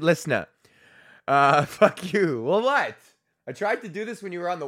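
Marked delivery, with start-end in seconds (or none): 1.3-3.0 laughing, slow. Real, not acted. Teasing his wife.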